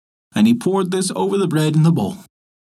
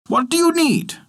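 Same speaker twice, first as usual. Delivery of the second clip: put-on much higher child voice calling out; high call through the window; children shouting; 14 semitones higher than usual. A narrator's reading. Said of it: "shouted to him through the window".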